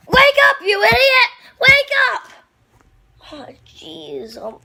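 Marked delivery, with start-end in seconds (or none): 0.1-2.3 high, loud yell of "wake up"; loud furious yell; angry shout. Acted. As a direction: scream at him to come to. Yelling at unconscious puppet.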